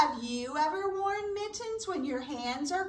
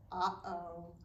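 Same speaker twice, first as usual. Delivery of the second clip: hushed uh-oh; 8 semitones lower, 8 dB quieter than usual. Acted. Suspense for children.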